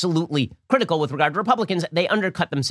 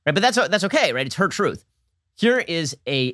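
usual speaking voice

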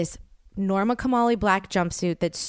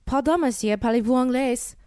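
lightly put-on French accent, a little higher; mock French accent; 4 semitones higher than usual. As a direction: try your best tourist French. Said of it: "I do my whole, like".

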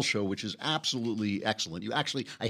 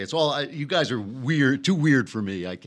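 normal talking voice

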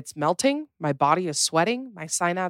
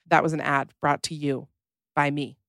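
ordinary talk

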